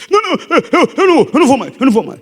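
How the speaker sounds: high, stammering cowardly squeal; mock panicked promise. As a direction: stammer a frightened promise, hammed up. Frightened man promises to stop. (promessa gaguejada e apavorada, imitada)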